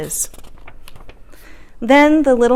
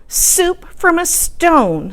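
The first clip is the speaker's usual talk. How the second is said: slow, higher voice full of wonder; old lady voice; 8 semitones higher, much slower than usual. Acted. Little old lady marvelling at her soup, slow and high. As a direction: savour each word in amazement.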